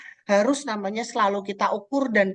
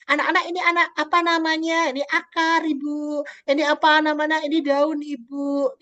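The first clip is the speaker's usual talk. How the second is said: higher, bright classroom teacher voice; teacher and pupils voice; 7 semitones higher than usual. Retold or imitated. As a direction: act out a teacher quizzing kids.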